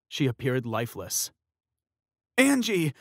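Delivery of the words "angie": high, loud panicked scream of a name. Acted. Fiancé finds his collapsed fiancée, screaming. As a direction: scream her name in horror. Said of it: "her fiancé Tyler screamed".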